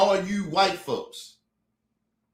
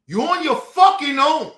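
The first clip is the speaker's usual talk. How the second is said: louder and higher, biting; emphatic send-off; firm loud warning; 4 semitones higher, 6 dB louder than usual. Real, not acted. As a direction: hammer home the refusal. Final defiant warning.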